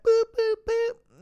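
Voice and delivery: high-pitched imitated bleeps; short, high beeps standing in for swearing; censor bleep. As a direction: mimic a censor tone.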